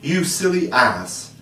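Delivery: high, slow, scornful fairy voice; rude fairy retort. A narrator's reading. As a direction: drag out the insult like a haughty fairy. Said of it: "Tink replied in these words".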